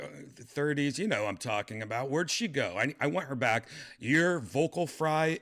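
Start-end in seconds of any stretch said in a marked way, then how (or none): 4.0-5.4 exasperated. Real, not acted. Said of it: "driving me crazy".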